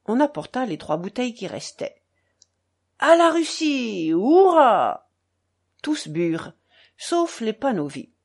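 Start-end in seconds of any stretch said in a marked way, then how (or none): 3.0-5.1 loud, drawn-out cheer; shouted toast. A narrator's reading. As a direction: roar out a high hurrah.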